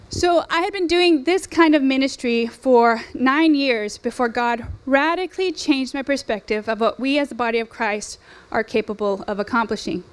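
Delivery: normal talking voice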